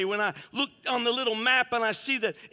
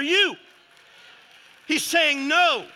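full-force preaching; 7 semitones higher, 7 dB louder than usual. Real, not acted. Preacher telling the devil he'll not cross the line, shouted preaching.

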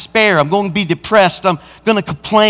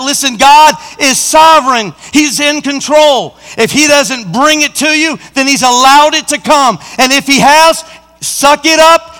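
high, insistent pulpit proclamation; impassioned sermon delivery; full-force preaching; 4 semitones higher, 3 dB louder than usual. Acted. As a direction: drive each point home with force.

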